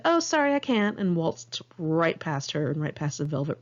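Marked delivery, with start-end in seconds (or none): 0.0-1.0 bright, high fake apology; sugary "sorry" that means no; mock sweet brush-off. Snubbing a friend in line, high fake-sweet apology.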